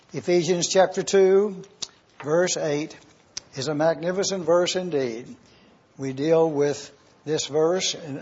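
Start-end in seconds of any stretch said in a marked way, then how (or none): none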